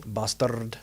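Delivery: "Bastard" with a long rolled R. Shown as a demonstration. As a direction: roll the R in "Bastard" like a purr. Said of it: "they roll their R's a lot".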